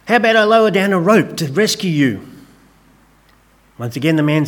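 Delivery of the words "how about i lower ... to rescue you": higher, called-out offer from the helicopter; yelled out. Retold or imitated. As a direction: shout down a rescue offer. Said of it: "yelled out".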